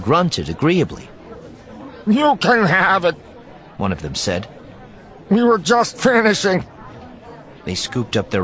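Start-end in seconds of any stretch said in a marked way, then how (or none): 2.1-3.2 high, loud creature voice; high-pitched alien offer; alien diner. A narrator's reading. 5.3-6.6 high, loud creature voice; high-pitched alien voice; alien diner. A narrator's reading.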